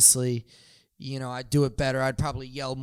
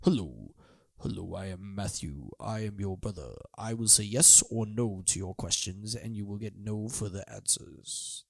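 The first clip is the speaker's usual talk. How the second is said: imitating Matthew; 5 semitones lower, 7 dB quieter than usual. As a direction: lower and much softer, a flat, withdrawn brother. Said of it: "Matthew's very".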